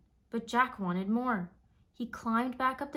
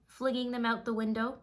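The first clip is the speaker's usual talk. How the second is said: ordinary delivery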